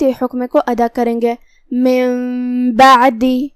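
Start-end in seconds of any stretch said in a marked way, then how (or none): 1.7-3.5 very slow من بعدی, نون said as a held nasal میم; iqlab with ghunna. Shown as a demonstration. Tajweed teacher demonstrating iqlab to students, very slow and nasal. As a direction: stretch من into a humming میم before بعدی. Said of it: "نون کو میم میں پلٹ کے غنہ کے ساتھ".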